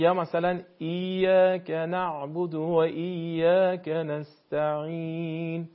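slow recitation, ع in نعبد and نستعین said lightly with no pressure; Quranic recitation. Shown as a demonstration. Teacher showing the caller an easy ع: slow relaxed recitation. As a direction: chant ایاک نعبد slowly, the ع relaxed. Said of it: "اصلاً هیچ فشاری نیست".

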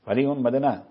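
ordinary talk

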